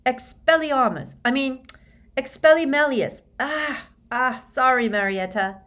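high, flustered and tripping over words. A narrator's reading. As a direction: gabble it in a nervous rush. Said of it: "rather wildly".

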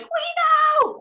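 very high-pitched mock scream; retold screaming back. Two cousins answering a fellow fan, mock screaming. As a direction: squeal it back like thrilled fans. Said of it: "they were screaming".

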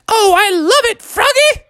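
very high delighted squeal; hammed-up lovey-dovey thrill; so excited. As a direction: gush over the prize in falsetto. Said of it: "she was so excited about it".

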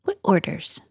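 hushed, low whisper; whispered question. Acted. Player questions a paladin's secret orders, whispered. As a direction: ask it under your breath in a whisper.

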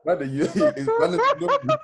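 loud, high, helpless laughter through words; laughing. Real, not acted. Defending an innocent gag question to friends, laughing. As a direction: squeal it out while cracking up.

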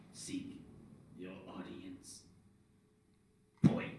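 hushed, slow stone-guardian voice; gargoyle voice. Acted. Gargoyles pointing the party up the stairs, hushed creature voice. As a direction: softly intone it as a gargoyle.

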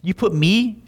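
usual speaking voice

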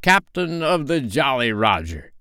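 high-pitched, grand pirate title; ringing mimicked captain; Hook. A narrator's reading. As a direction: announce the rank proudly, as an impostor captain. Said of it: "replied the voice".

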